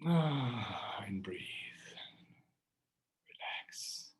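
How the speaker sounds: faint breathy whisper, fading out; soft spa whisper. Acted. Meditation fades to whisper.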